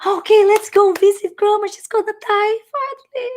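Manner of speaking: high, bright-eyed family member planning the hospital visit; cheerful relative. Pretend. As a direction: sing it out like a cheery trip to see grandma. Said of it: "I believe it would be like".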